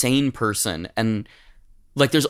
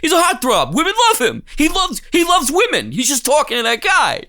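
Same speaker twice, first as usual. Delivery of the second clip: loud, very high mock outrage; shouting in hammed-up disbelief; angry Paul Sorvino; 4 dB louder than usual. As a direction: imitate a hot-tempered man frantically defending someone. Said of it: "Paul Sorvino's like".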